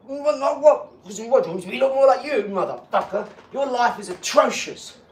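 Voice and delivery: loud, high indignant outburst; angry rant. Real, not acted. Ranting at those who tell him to calm down, loud and angry. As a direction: tear into the people who judge him.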